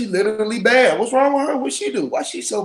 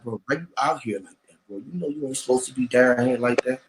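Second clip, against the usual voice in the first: a low, soft, disapproving stranger's voice; lower quoted stranger; 9 semitones lower, 6 dB quieter than usual. Retold or imitated. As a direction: sound like a stranger quietly scolding him. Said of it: "I had a motherfucker ask me".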